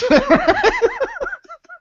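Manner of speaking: a sudden high squeal of a laugh; high burst of laughter. Real, not acted. Laughing at the absurd speed trap story.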